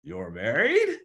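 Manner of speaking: mildly acted stunned question; retold disbelief. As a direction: question it as if you can't believe it. Learns his girlfriend is married.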